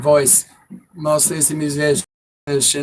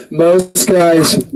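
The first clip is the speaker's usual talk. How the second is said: ordinary talk, nothing marked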